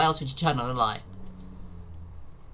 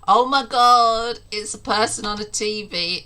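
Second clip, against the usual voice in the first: mock shock; 8 semitones higher, 7 dB louder than usual. Mock shock at a ghost video.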